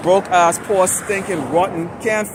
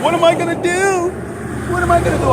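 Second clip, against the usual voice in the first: high, whiny mocking whimper, much higher than usual; mock panic; 11 semitones higher than usual. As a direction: sneer at them by aping a frightened, helpless man.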